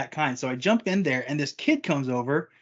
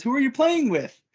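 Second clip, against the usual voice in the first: mom calling in; 9 semitones higher than usual. Retold or imitated. Mom checking on son.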